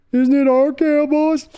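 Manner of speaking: put-on timid boy answering his father; whiny little son. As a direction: beg your daddy not to yell. Son voiced asking father.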